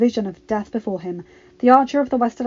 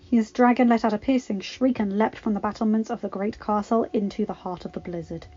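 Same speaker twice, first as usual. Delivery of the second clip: ordinary delivery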